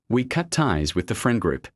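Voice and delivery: usual speaking voice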